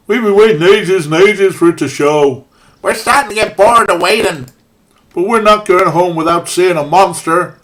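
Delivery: put-on loud, bouncy Midwest accent; hammed-up Fargo-style Midwest accent. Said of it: "my best approximation of some sort of Midwest accent".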